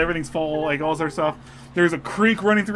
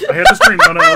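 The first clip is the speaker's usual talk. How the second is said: much higher, loud, pleading to be heard; excited insistence; 12 dB louder, faster than usual. Real, not acted. Husband insisting his dream be heard, excited. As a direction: insist over the laughter.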